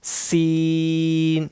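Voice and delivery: slow سین with a firm hissing whistle on the s. Shown as a demonstration. Teacher demonstrates a whistling letter, slow. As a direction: stretch سین, whistling the s hard. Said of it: "سختی سے پڑھنا ہے".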